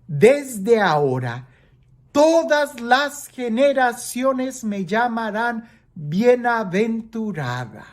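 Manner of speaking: muy pausado, sube en "todas las generaciones"; proclamación solemne. Acted. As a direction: proclama el versículo alargando cada palabra. Proclama el versículo, luego lo comenta. (lectura solemne, lenta y enfática)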